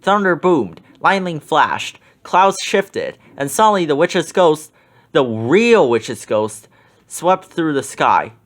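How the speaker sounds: plain reading voice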